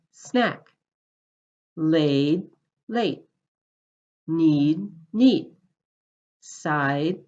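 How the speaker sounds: first word held longer, second clipped with a rising pitch; vowel length pairs. Shown as a demonstration. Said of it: "I'm going to say each pair".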